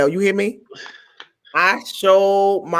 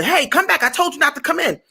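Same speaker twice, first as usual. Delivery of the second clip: high, scolding call back; mock stern guard; 8 semitones higher than usual. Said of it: "security guard was gonna tell".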